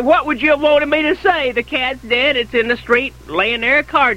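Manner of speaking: mock defensive outburst; imitated exasperation. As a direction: throw the question back in protest. Retort in an acted quarrel.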